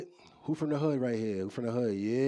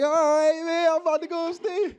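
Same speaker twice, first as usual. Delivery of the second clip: high, loud comic holler; mock-excited shout back; hammed-up excitement; 8 dB louder than usual. His excited answer, retold.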